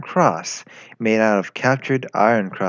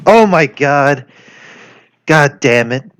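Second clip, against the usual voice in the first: loud, higher exclamation of dismay; aghast; 4 semitones higher, 8 dB louder, slower than usual. Acted. At her strange confession, loud exasperated dismay. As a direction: react as if something's gone terribly wrong. Horrified at the date's remark.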